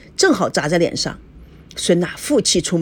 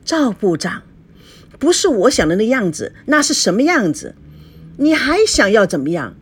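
higher-pitched, indignant wife; angry confrontation; 7 semitones higher than usual. A narrator's reading. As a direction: pile up accusing questions, voice climbing. Wife confronting husband in quarrel. (气愤地质问)

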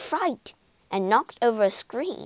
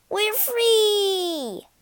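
joyful cry; 12 semitones higher, 5 dB louder than usual. Acted. Freed slaves dance with joy.

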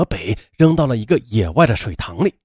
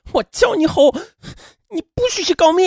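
high, strained rage; through gritted teeth; 14 semitones higher than usual. A narrator's reading. Woman strangling her friend, high strained furious voice. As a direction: hiss the threat while choking her. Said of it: "咬牙切齿地说".

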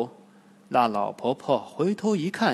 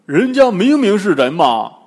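Pigsy's voice; 5 semitones higher, 13 dB louder than usual. Acted. Bajie contradicts Wukong.

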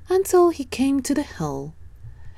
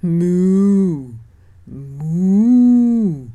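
long, louder lowing moo; cow mooing; 6 dB louder than usual. Acted. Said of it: "said a cow".

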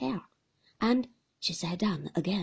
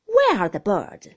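put-on a louder, brisk elderly aunt; stern old aunt; 11 dB louder than usual. Aunt Betty questions the young mother, stern and loud. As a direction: demand it like a stern old lady. Miss Betty asks sharply.

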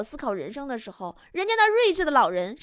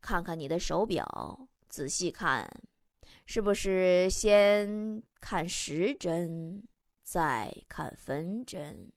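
put-on much lower, softer, slow old man; slow, soothing old-man wisdom; low, slow elder consoling a young man; comforting old man; 7 semitones lower, 4 dB quieter, much slower than usual. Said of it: "就安慰他说".

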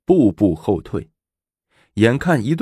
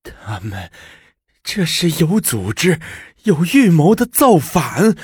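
angry huff; 9 semitones higher than usual. Acted. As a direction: rant at the plot against her. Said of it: "气呼呼地说".

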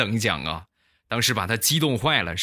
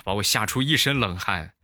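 ordinary delivery

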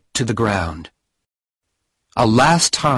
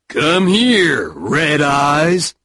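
gruff fat man; 5 semitones higher, much slower than usual. Acted. Fat man calls the dog.

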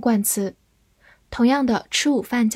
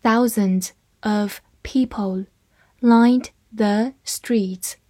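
slow, careful read-through, each word clear; slower than usual. Shown as a demonstration. Teacher reads slowly to the class, slow and clear. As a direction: read it slowly so students can follow. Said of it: "好，慢慢来读".

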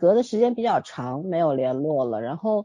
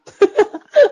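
loud burst of laughter; 10 dB louder than usual. Real, not acted.

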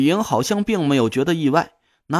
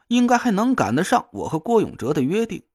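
plain reading voice

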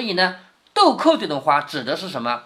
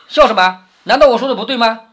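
indignant challenge, raised voice; loud protest; 3 semitones higher, 7 dB louder than usual. Acted. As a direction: demand to know what's so funny. 薛蟠反问笑他的众人. (大声不服地反问)

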